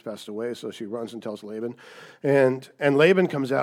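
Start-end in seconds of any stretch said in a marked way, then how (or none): none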